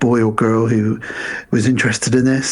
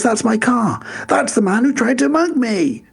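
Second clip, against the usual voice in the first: much higher, indignant posh complaint; retelling a posh man outraged at seeing his car; high outraged cry; 11 semitones higher than usual. Retold or imitated. Aykroyd's character spotting his limo.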